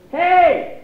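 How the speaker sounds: high, loud yell of Hey; road-rage yell. Retold or imitated. Dad yelling at a driver, acted road rage. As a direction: bellow it at another driver.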